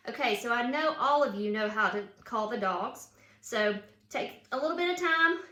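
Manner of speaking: usual speaking voice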